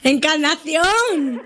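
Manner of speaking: high, drawn-out call of the name. Real, not acted. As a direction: stretch the name out slowly, high. Calling out to the caller.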